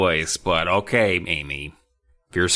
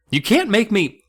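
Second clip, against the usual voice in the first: scared defiance; 13 semitones higher, 4 dB louder than usual. Acted. Frightened defiance.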